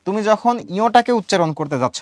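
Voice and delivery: ordinary talk, nothing marked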